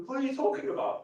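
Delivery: lightly voiced skeptic; mock audience. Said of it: "you guys go".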